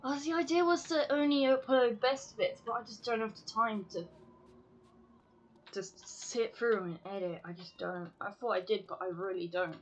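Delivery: normal talking voice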